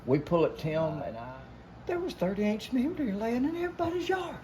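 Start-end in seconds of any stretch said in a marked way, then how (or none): 1.9-4.3 high, amazed telling; excited disbelief. Real, not acted. Hunter amazed telling friends about giant deer everywhere. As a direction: marvel at it, voice jumping up.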